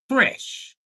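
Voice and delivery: normal talking voice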